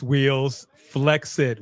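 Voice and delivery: usual speaking voice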